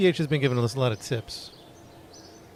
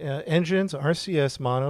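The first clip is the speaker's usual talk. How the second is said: usual speaking voice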